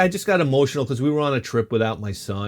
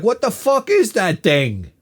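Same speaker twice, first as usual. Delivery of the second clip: higher, slower, appalled; mock horrified; 8 semitones higher, 4 dB louder, slower than usual. Six-year-old him seeing the alien, mock horrified. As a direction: stretch it out in mock horror.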